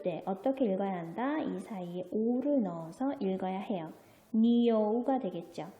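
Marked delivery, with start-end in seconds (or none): none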